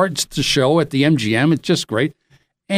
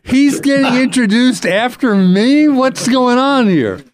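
higher pitch, baffled and laughing; mock indignation; 7 semitones higher than usual. Imagined [name]'s outrage.

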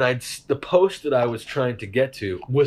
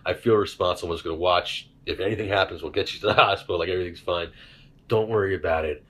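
reassuring friend; 6 semitones lower than usual. Retold or imitated. Friend sitting by the bed, soothing him in a low voice. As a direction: talk him down gently.

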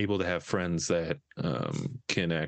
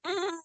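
choked hum; 3 dB quieter than usual. Retold or imitated. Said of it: "I was like".

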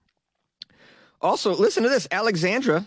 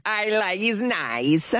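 goofy mock-Kazakh accent, pitched up; Borat; 6 semitones higher, much slower than usual. Imagining how Moroccan listeners talk, in a Borat accent. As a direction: do a broken-English Kazakh comic accent. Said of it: "that Borat accent, but I imagine they do".

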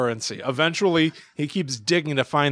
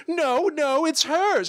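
very high, protesting falsetto; mock coquettish sister; coquettish old lady; 13 semitones higher, much slower than usual. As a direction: coyly pass the blame in a high voice. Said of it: "they're both acting, like, coquettish".

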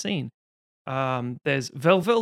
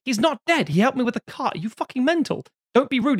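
indignant husband; 8 semitones higher, 3 dB louder than usual. Retold or imitated. Husband argues with wife.

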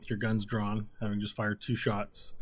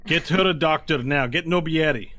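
urgent command; 5 semitones higher, 7 dB louder than usual. Acted.

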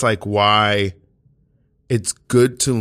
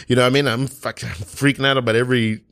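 normal talking voice

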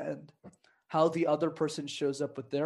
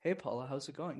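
softer, calmer matching greeting; softer greeting to a shy host; toned-down reply; 8 dB quieter than usual. Shown as a demonstration. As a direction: bring your energy down to meet her. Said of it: "Notice how now immediately my vocal tone went".

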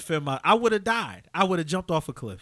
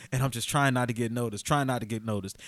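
softer, shrinking into the line; trying not to be noticed; 8 semitones lower, 6 dB quieter than usual. Retold or imitated. Freshman in line hoping nobody notices him, softer and shrinking. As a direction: say it small so nobody notices you.

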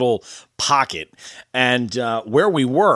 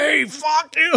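high, pitched-up mock yell; mock scream; 14 semitones higher, slower than usual. Host replays the buddy yelling at the shore guy, mock yell. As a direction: squeak it out like the snapping buddy. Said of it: "screamed at this guy".

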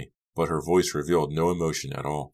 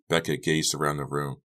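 ordinary delivery

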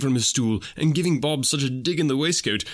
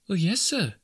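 higher, lightly voiced housemaid; a polite young maid, higher than the narrator; 7 semitones higher than usual. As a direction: answer politely as a young serving girl. Maid answers Scrooge at door.

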